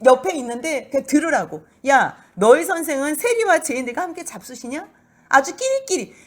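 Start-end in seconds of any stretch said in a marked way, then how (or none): 1.9-4.9 high, loud taunting question; a Pharisee taunting Jesus's disciples, mocking and loud; mocking sneer. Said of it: "비웃는 거지요". 5.3-6.1 sneering. Acted. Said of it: "비웃는 거지요".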